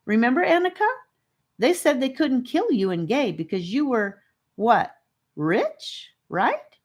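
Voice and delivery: put-on small boy chiming in; young boy voice. A narrator's reading. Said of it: "Charlie piped up".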